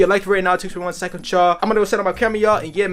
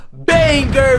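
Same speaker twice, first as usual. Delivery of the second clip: hyped drawl; 6 semitones higher, 6 dB louder than usual. Real, not acted. Stretched hype word.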